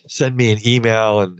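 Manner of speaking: ordinary talk, nothing marked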